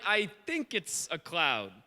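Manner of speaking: peer and guess, low and halting; hesitant servant. Retold or imitated. Servant squinting at a far-off cloud, hesitant. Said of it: "He's like".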